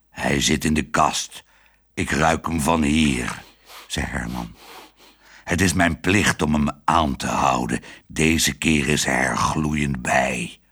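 low, dutiful dog voice, slowing at the end; a proud dog doing his duty; stern guard dog. A narrator's reading. Dog Herman accusing the father, low and stern.

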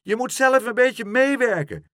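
high, loud, urgent shout. A narrator's reading. Boy hoisting the horse, shouting. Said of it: "schreeuwde Pluk".

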